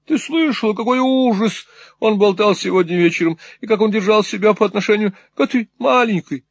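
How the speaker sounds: lightly voiced self-important matron; Mrs Nickleby lamenting. A narrator's reading. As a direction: convince her daughter he went mad for love.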